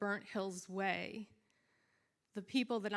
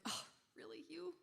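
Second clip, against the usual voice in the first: disappointed reaction; 9 semitones higher, 6 dB quieter than usual. Retold or imitated. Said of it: "with each envelope, you're like".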